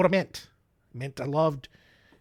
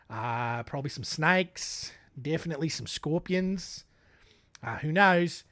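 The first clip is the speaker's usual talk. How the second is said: usual speaking voice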